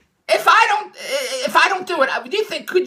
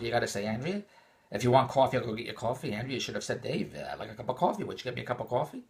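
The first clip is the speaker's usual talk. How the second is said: low, soft imitated advice; calm advice; 8 semitones lower, 10 dB quieter than usual. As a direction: voice a friend's reasonable suggestion, quietly. Voicing a friend's reasonable advice.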